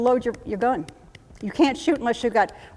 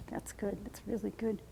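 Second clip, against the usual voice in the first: calming the patient; 16 dB quieter than usual. Pretend. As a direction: gently settle an agitated soldier. Calming agitated patient in role-play.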